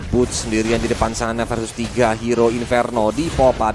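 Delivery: ordinary delivery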